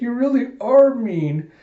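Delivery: put-on high, drawn-out child's complaint; childish reproach. Anya answers Damian, hurt.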